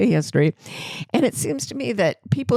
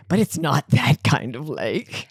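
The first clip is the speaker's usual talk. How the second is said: slow, lower, breezy dismissal; mock realtor; 5 semitones lower, slower than usual. Realtor dismissing flooding worries, slow and lower. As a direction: brush it off like a clueless realtor. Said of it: "And she said".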